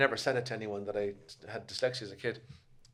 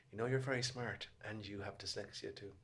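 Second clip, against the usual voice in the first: gentle teacher; 2 semitones lower, 13 dB quieter than usual. Retold or imitated. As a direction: hand it to the boy softly. Teacher's kind words retold.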